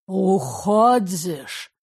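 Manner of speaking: put-on slow, slurred drunken question; drunk slurring. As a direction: slur it like a drunk. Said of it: "заплетающимся языком, еле внятно".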